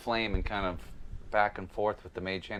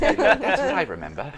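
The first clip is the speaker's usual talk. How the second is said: southern accent; 6 semitones higher, 8 dB louder than usual. Shown as a demonstration. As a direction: put on a Southern accent and laugh through it. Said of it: "Now I'm speaking with a southern accent".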